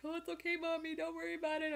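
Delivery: high sweet little dog voice, forgiving; voicing the dog. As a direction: play the dog comforting Mommy, pitched high. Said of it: "He's like".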